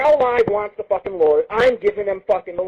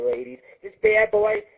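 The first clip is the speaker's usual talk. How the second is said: usual speaking voice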